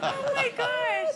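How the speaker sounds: slow, high, loud squeal through laughter; real amused delight. Real, not acted. Thrilled at [name]'s snort, laughing delight.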